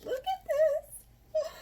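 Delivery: high, slow, near-tears gush; teary squeal. Real, not acted. Vlogger holding up the books, slow teary squeal. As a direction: squeal it out slowly, almost crying.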